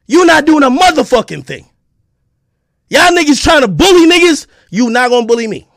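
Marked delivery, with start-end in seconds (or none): none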